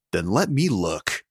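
ordinary delivery

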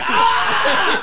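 high startled breathy shout; mock gasp shout. Friends shocked by Gabe's comeback, high gasp. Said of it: "We're like".

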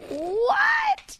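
squeaky, high disbelieving what; sudden high shocked squeal. Retold or imitated. Stunned reaction to brother's scream.

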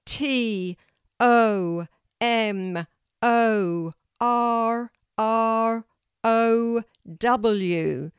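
high, long, loud letters; loud spelling out. A narrator's reading. Kelty corrects her spelling for the tree, loud and slow. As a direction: sing out each letter slowly and firmly.

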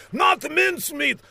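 high, pleading cartoon outburst; villain shouting. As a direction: protest in a high, hammed-up shout. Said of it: "shouted Laszlo".

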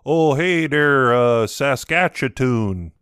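slow, loud, hammed-up Canadian accent. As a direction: drawl it like a friendly Canadian. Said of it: "let me do my, uh, my Canadian accent".

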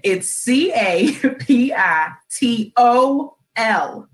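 C A P I T O L, each letter slow and separate; spelled out slowly. Shown as a demonstration. Host correcting listeners' spelling, slow letter by letter.

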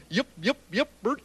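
a hint of a little alien chirping yip; Martian voice. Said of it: "And they kept saying".

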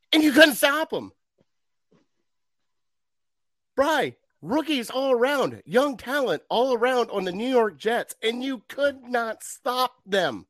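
high, incredulous rant about the defense; exasperated rant. Real, not acted. Fan ranting to his co-host, incredulous and high. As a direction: pitch it up in disbelief.

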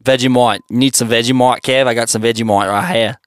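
deep, raspy mock Australian voice; Australian accent. Friend voicing Kev offers Vegemite, low mock Australian accent. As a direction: ham up an outback bloke offering Vegemite. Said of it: "just a raspier Australian".

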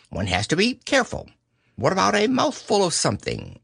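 a lightly voiced nervous rabbit host; Rabbit's voice. A narrator's reading. Rabbit voiced high in story.

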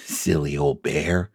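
soft, slow, low aside; laughing to yourself. A narrator's reading. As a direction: murmur it fondly, as if to yourself. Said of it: "you laughed to yourself".

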